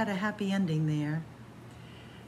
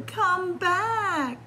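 much higher call after someone leaving; pleading call; 10 semitones higher than usual. Acted. Reading to kids, the chameleon calls high after the grasshopper. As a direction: beg them to come back.

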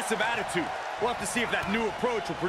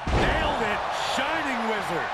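excited shout; 3 semitones higher than usual. Acted. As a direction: shout it as it lands. Commentator calls finishing move.

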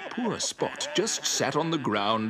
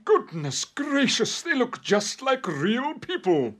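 astonished; 13 semitones higher than usual. Acted. Shown gingerbread men like real people, high astonishment.

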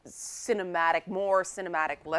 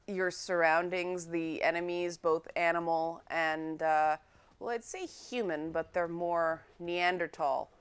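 ordinary talk

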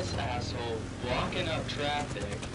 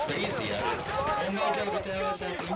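frantic; 7 semitones higher than usual. Real, not acted. Chaos of battle.

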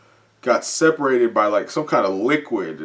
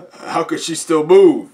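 louder, higher stunned question; surprised monster; 6 semitones higher, 8 dB louder than usual. Acted. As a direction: sound startled. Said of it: "says the female Nomu".

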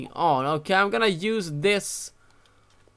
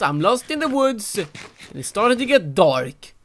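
usual speaking voice

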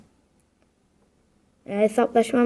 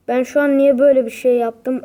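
normal talking voice

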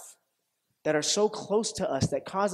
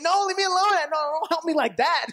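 high, loud mock protest; upset refusal; 10 semitones higher, 5 dB louder, faster than usual. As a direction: push the helper away, exasperated. Acts out refusing the help.

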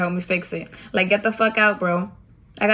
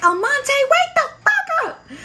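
furious shout; slower than usual. Retold or imitated. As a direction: scream the recruit awake. Chief yelling at sleeping recruit.

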